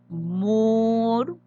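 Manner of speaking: murciélago muy lento, sílaba por sílaba; separado en sílabas. Shown as a demonstration. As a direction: alargar cada sílaba: mur-cié-la-go, con pausas. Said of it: "Vamos a dividir murciélago". (murciélago dividido en cuatro golpes lentos)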